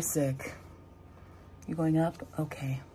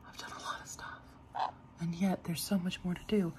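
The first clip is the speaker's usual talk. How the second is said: soft whisper; 9 dB quieter than usual. Real, not acted. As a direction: hush your voice. Unvoiced whisper.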